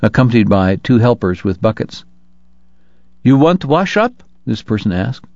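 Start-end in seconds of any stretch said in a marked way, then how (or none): 3.2-4.2 higher-pitched Chinese laundryman, broken English. Acted. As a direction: pitch it up as a Chinese bathhouse man. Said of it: "middle-aged Chinese carrying a large tin bathtub".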